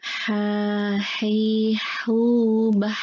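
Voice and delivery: breathy "ha" from mid-throat, each syllable apart; reciting ha syllables. Shown as a demonstration. Teacher drilling the letter ha for students, breathy. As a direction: push air out on every "ha". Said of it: "Saya ulang ya".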